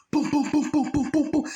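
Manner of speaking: high, punched gunfire imitation; imitated gunshots. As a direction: mimic a burst of shots with your voice. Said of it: "He just started shooting".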